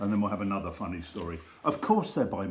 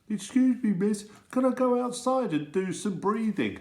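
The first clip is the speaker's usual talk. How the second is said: a lightly voiced, higher pleading child; timid pupil; 11 semitones higher than usual. Pupil asking the strict teacher to go breathe, small high voice. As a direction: ask permission like a nervous kid. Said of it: "someone would say".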